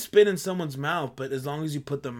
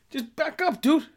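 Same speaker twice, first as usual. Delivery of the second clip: much higher pleading; 11 semitones higher, 4 dB louder than usual. Real, not acted. Asking crowders to just back up, higher plea. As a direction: plead for a little space.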